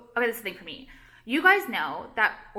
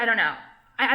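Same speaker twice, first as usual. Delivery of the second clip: usual speaking voice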